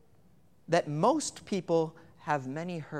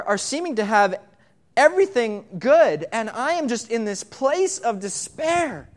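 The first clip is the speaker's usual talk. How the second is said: impassioned lament; 5 semitones higher, 6 dB louder than usual. Acted. Voicing David's anguish, preaching.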